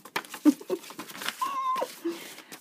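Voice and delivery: high-pitched squealing laugh; amused laugh at your own performance. Real, not acted. Laughs after own dramatic reading.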